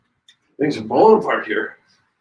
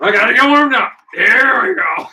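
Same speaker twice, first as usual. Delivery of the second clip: excited shout; 9 semitones higher, 6 dB louder than usual. Acted. Hyped up before race.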